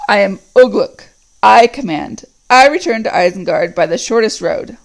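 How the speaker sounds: loud, commanding orc growl; growling Ugluk. Acted. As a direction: claim command over them all. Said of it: "said the growling voice".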